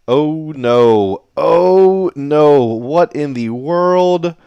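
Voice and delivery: loud, high disbelieving "oh no"; real loud dismay; shocked outcry. Real, not acted. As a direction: cry out in shock at the miss. Commentator reacts to a terrible dart.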